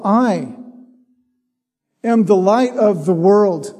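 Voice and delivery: "I" held alone, a long pause before "am"; stress on I. Shown as a demonstration. Preacher reading John eight to the congregation, stress on "I". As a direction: set "I" apart, wait, then continue. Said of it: "The stress is on the "I".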